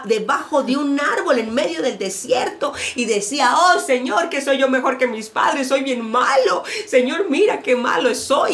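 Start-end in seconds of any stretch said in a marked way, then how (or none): 3.6-8.5 loud, raised lament; mock self-pity. Depressed Elijah complaining to God, loud lament. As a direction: wail it to God, overdone. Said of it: "se fue deprimido".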